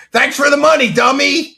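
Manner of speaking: loud, high mocking shout; DSP. Streamer thanking an insulting super chatter, loud mocking impression. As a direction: imitate a streamer gloating at a donor. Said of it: "I do the D S P thing".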